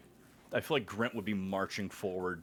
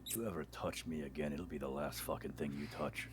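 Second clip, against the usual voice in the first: softer, quicker menacing warning; low threat; 4 semitones lower, 6 dB quieter, faster than usual. Acted. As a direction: warn him off quietly, with menace. Grint threatens the captain.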